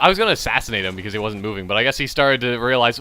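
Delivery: ordinary talk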